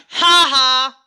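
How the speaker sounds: loud, high taunting "ha ha"; mocking laugh. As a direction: laugh right in his face, gloating. Mocking the boy who tripped.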